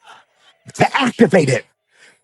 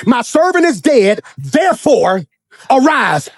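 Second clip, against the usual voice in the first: preaching pitched higher with growing insistence; a stern preacher's build; rising preaching; 4 semitones higher than usual. Acted. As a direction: press the point home from the pulpit. Preaching builds on God's words.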